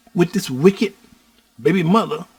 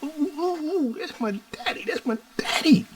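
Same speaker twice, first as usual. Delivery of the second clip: put-on much higher, squealing little-girl glee; excited baby voice; 12 semitones higher, 5 dB quieter than usual. Father voicing his unborn daughter's delight, high squeal. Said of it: "she just got all, all excited".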